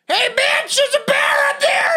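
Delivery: high, fast screaming; screaming old women. Retold or imitated. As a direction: scream it from above. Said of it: "screaming at her".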